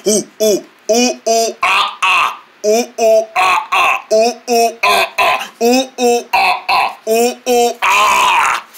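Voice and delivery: ooh ooh ah monkey calls; monkey noises. Acted. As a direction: chatter like a monkey.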